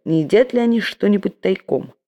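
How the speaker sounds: ordinary delivery, nothing marked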